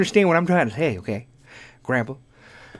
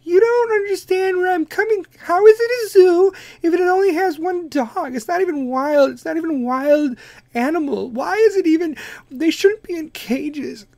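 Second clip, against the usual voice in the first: Kim Kardashian voice; 12 semitones higher than usual. Pretend. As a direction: play a confused Kim, pitched way up. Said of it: "the Kardashian voices".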